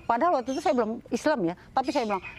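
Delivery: normal talking voice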